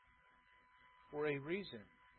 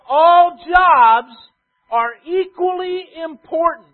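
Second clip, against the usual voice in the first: loud, slow, higher preaching crescendo; full-force preaching; 6 semitones higher, 16 dB louder, slower than usual. Acted. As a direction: build to a shouted climax on all jobs.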